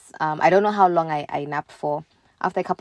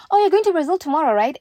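high, quick, louder mock questioning; excited questioning; 11 semitones higher, 4 dB louder, faster than usual. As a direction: imitate eager, nosy people. Other people quizzing her excitedly.

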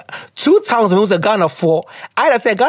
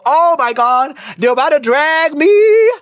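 excited; 8 semitones higher, slower than usual. Real, not acted. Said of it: "I feel like I'm gonna offend".

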